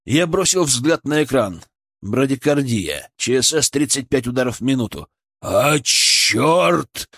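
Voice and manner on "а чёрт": mild exasperated curse; cursing. A narrator's reading. Colleague swears as the patient's pulse drops, cursing. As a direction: swear in understated frustration. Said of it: "выругался Лемигов".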